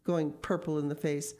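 plain reading voice

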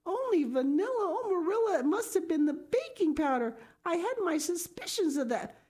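put-on high, anxious girl's voice; flustered Anne. A narrator's reading. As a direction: guess nervously at the cause.

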